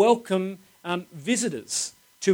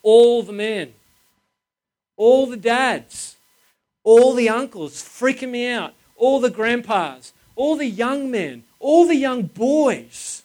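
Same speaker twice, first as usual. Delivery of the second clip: higher and louder, slow, every "All" hammered; building emphatic outcry; 6 semitones higher, 5 dB louder, slower than usual. Real, not acted. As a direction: preach it, pounding each "All the" line. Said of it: "Can you see the emphasis?".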